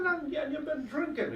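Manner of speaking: high, pointed accusation; garda's voice. Acted. Garda telling the driver he has been drinking, high and pointed. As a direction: lift it up like a sharp policeman.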